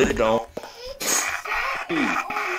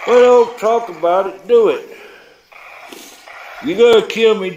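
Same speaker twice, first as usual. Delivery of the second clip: higher, louder provoking dare; taunting challenge; 6 semitones higher, 4 dB louder than usual. Real, not acted. Taunting a demon to attack him, loud and high. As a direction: dare it to come at you.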